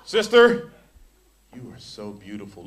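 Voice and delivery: lightly hammed-up come-on, voice sinking low; mock sensual compliment. Preacher mocking a brother flirting with a sister, lightly sensual. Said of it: "All sensual".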